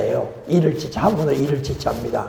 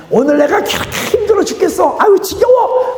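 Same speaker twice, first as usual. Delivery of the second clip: whining complaint; 13 semitones higher, 4 dB louder than usual. Retold or imitated. Mocking a tired complainer.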